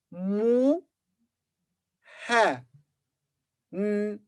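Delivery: "mu, ha, n" as separate sounds with long pauses. Shown as a demonstration. Teacher spells out the word for engineer, "mu, ha, n" sound by sound. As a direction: sound out "mu", then "ha", then a lone "n". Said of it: "So it will be".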